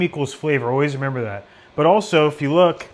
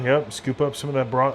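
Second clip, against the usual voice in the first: usual speaking voice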